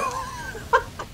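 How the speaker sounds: soft, high, breathy laugh; laughter. Real, not acted. Gamer after cursing the enemy, soft laughter. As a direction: break into a quiet, airy laugh.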